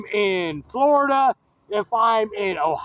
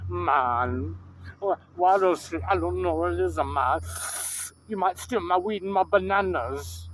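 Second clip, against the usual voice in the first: put-on Jamaican accent with stretched "man"; hammed-up Jamaican island voice; mock Jamaican accent; 4 dB quieter than usual. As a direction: imitate a suspicious Jamaican clerk. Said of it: "because you're a Jamaican".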